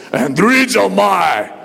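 slow, booming strongman delivery; deep bassy voice. Shown as a demonstration. Preacher showing how to say the Greek word, deep bassy boom. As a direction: boom it out slowly like Arnold Schwarzenegger. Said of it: "say it with a deep, bassy voice".

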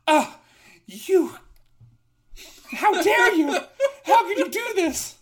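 very high, loud indignant squawk; outraged robot. Acted. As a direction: shriek in laughing outrage. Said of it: "The robot goes".

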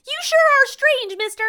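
hammed-up little boy; Reese. Eleven-year-old to the odd stranger, little boy voice. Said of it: "this little eleven-year-old boy".

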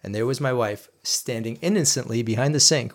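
usual speaking voice

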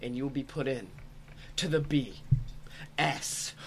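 hammed-up announcer plug; ad read. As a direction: sell it like a pitchman. Sponsor ad read.

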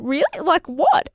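high, surprised really, what; surprised question. Retold or imitated. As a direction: sound caught off guard. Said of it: "and I said".

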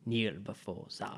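low, slow, hushed Zod growl; a hint of General Zod, drawn out. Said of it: "telling people to kneel before him".

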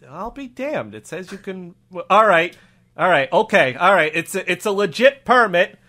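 cop reading and reluctantly conceding; accented officer, subdued then pressing his question; lightly puzzled local cop; grudging cop. Acted. Cop inspects the permit.

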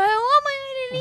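high-pitched mock alarm; excited calling out. Daughter shows mother the snake man, excited high call. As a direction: point him out urgently, pitch way up.

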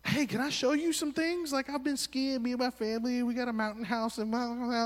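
high, bubbly know-it-all voice; eager college kid. Retold or imitated. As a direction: oversell your skiing family. Eager student offering help.

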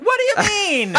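very high-pitched squawk of disbelief; mock incredulity. Storyteller to the stranger who shrugged it off, incredulous. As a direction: retell it as a comically outraged yelp. Retold baffled reply to stranger.

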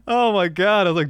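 normal talking voice

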